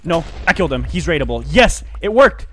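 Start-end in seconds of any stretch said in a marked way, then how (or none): 1.5-2.4 loud, raised victory cry; triumphant. Real, not acted. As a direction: celebrate the kill out loud. Celebrating a successful kill.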